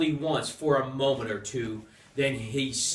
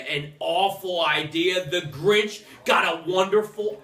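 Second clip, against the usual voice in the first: ordinary delivery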